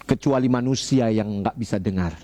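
usual speaking voice

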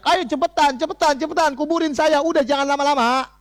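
high urgent cry of a righteous corpse, hurrying the bearers; a dead man calling out to be buried quickly; high-pitched pleading to hurry, the dead man's voice; shouting corpse. Acted. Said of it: "teriak-teriak".

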